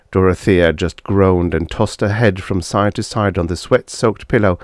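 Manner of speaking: ordinary delivery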